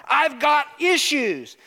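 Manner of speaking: drawn-out high cry for help, hammed up; mock alarmed cry. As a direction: call out like a patient in a panic. Mom rushed to ER, acted out.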